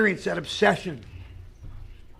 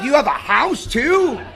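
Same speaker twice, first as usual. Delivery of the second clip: higher, slow drawn-out astonished question; mock incredulity; 6 semitones higher, slower than usual. God learning the crying man has a house, mock incredulous. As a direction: stretch it out in mock amazement.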